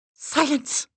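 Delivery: loud, high, sharp order; commanding. A narrator's reading. Assistant quelling the outburst, unmistakable command. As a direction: silence the room with one word. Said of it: "voice was low, the command unmistakable".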